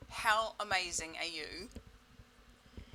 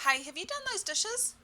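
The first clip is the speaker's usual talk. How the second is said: higher, louder repeat of the nag; a bit louder; 12 semitones higher, 4 dB louder than usual. Retold or imitated. Parent asking a second time about the dishes, higher and louder. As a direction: ask again with more push. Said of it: "they say a bit louder".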